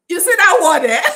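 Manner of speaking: can't hold back the laugh. Real, not acted. Telling the class about the secretary, laughing.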